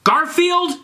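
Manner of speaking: high, loud scream of his name. Acted. Ebony screams at Garfield, high loud. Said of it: "screamed Ebony".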